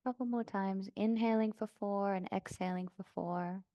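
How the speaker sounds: calm yoga-teacher meditation voice, long pauses; soft, settled breathing-practice guidance; guided meditation. Real, not acted. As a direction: guide them gently into stillness, unhurried. Leads seated breathing meditation.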